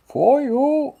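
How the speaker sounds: very high, loud theatrical menace; hammed-up villain impression. Finishing the bit for co-host, high mock villain voice. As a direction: land the silly villain threat. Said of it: "I promise I'm done".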